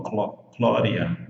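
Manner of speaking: Glória said slowly, vowel by vowel; slow teacher's Glória; slow model word. Shown as a demonstration. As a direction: stretch out Glória, O and final A clear. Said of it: "A U com som de O".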